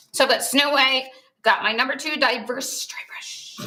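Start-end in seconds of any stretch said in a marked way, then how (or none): 2.9-3.6 soft whisper trailing off; playful hushed product plug. Pretend. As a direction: let the words sink into a whisper. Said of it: "Why is she whispering?".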